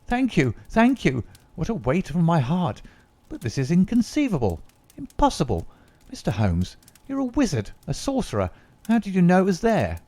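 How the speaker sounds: relieved secretary, gushing and amazed; overjoyed relief. Acted. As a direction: pour out thanks in disbelief.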